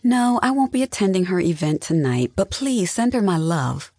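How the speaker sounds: high, prim, sugary refusal; sweetly formal, mildly sarcastic; pointedly polite. A narrator's reading. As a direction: decline with exaggerated politeness. Sister declines with pointed politeness.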